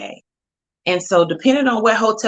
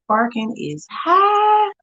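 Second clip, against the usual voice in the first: outraged exclaim; 12 semitones higher, much slower than usual. Real, not acted. To viewers about Chicago parking, a slow high outraged cry. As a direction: drag out the outrage at the price.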